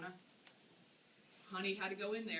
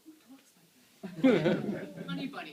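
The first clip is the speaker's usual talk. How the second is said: amused disbelief; 5 semitones higher, 17 dB louder, slower than usual. Retold or imitated. Bartender's amused reply.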